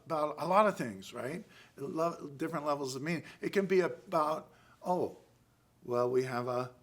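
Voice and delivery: ordinary talk, nothing marked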